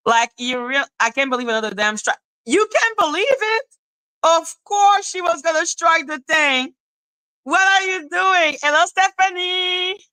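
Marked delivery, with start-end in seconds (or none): 2.5-10.0 high-pitched, animated exclaiming. Real, not acted. Live streamer reacting to chat and greeting Stephanie, animated exclaiming. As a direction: exclaim up high, drawing out the ends.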